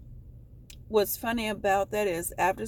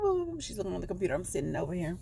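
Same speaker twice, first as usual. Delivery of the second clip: normal talking voice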